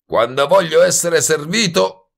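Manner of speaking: high, loud indignant yell; yelling after someone. A narrator's reading. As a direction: holler it at a departing waiter. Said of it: "gli urlò dietro Goebel". (urlo acuto e indignato)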